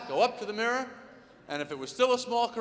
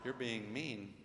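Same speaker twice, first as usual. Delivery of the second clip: very soft, low reproach; hurt wife; 11 semitones lower, 12 dB quieter, slower than usual. Acted. Wife reproaching her hungry husband, quietly hurt. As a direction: drop to a small, low, wounded voice. Acted wife's hurt reply.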